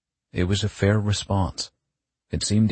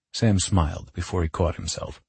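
ordinary delivery

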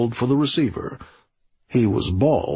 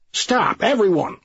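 sharp high yell to silence them; 10 semitones higher than usual. Acted. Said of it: "yelled the sergeant".

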